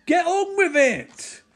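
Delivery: high, loud, drawn-out exasperation; impatient shout. Real, not acted. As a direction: holler impatiently at the film. Fed up with overlong effects scene.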